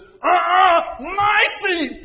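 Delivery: put-on very high, loud whiny child's yell; child shouting protest. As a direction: squeal it like an indignant kid. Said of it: "what the other one says".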